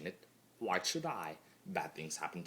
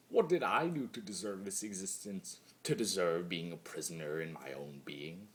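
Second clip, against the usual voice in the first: ordinary delivery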